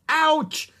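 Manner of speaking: high, loud yelp of pain; pained cry. A narrator's reading. Jack cries out as thorns stick his hand, pained cry. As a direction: cry out at a sudden prick. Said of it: "he cried".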